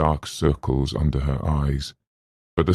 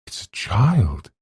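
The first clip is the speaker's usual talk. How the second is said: disbelief; 4 semitones higher than usual. Acted. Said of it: "with disbelief".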